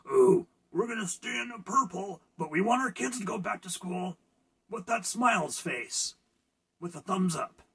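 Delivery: hammed-up sing-song politician; mocking the governor. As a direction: parody the governor as chirpy and smug. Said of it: "our governor says".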